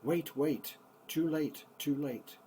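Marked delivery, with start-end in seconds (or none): none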